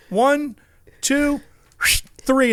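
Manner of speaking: high, deliberate countdown with pauses; John Force. Retold or imitated. Guest counts before hurling lamp overboard, high and deliberate. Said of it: "He goes".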